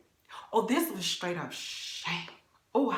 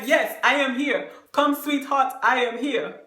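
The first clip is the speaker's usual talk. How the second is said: date calling out; 4 semitones higher, 7 dB louder than usual. Retold or imitated. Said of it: "he's like".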